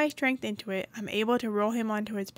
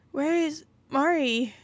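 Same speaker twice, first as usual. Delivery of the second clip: put-on higher, louder pleading girl; a frightened girl asking where her sister is; higher girl's voice, worried; anxious young girl voice; 5 semitones higher, 4 dB louder, slower than usual. Man confronts, girl asks for sister.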